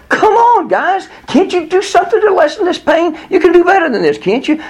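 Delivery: very high, strained retold protest; exasperated pleading. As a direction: plead in frustration with the doctors. Retelling his pleading thoughts during wife's pain.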